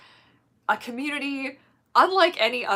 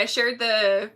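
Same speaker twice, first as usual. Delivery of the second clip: ordinary delivery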